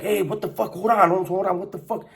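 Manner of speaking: high, urgent "hold on, Holmes"; imitating guys breaking up a fight; mock alarmed break-up. Bulldogs stepping in between them, urgent. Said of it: "got in the middle, like".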